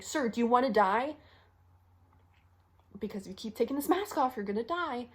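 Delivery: fed-up, pressing plea; exasperated warning. Acted. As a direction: confront a stubborn patient urgently. Acted confrontation with a stubborn patient.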